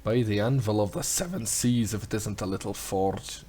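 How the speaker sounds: a hint of a gruff Scottish sailor; lightly voiced old sea dog smith; pirate Scottish smith. Voicing the smith NPC.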